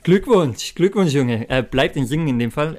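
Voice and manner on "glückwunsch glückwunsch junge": excited congratulations. Real, not acted. Congratulating the winner.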